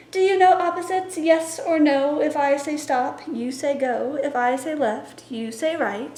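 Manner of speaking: bright, lively picture-book reading; playful call-and-response reading; story time read-aloud. Acted. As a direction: read it to a room of toddlers.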